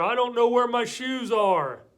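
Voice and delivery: put-on loud, high protesting kid voice; exasperated kid. Child answering mom about lost shoes, exasperated yell. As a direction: yell back like a frustrated child.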